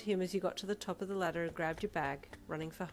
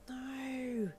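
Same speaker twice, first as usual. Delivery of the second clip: soft wail; 7 semitones higher than usual. Acted. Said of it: "she wailed softly".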